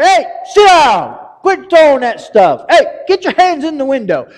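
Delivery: bus driver yelling. Retold or imitated. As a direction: bark at rowdy kids on the bus.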